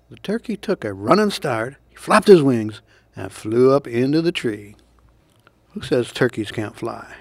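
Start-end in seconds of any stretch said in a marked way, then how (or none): none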